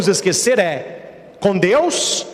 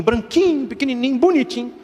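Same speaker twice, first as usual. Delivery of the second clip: cooing cute; 5 semitones higher than usual. A narrator's reading. Describing cute piglet.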